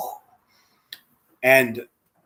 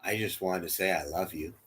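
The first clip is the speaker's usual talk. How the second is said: tender confession; 6 semitones lower, 3 dB louder than usual. Retold or imitated. Old husband tells his wife he loves her, tender. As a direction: breathe out, then say it gently. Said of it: "he goes".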